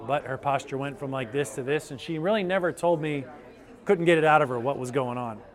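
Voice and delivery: ordinary talk, nothing marked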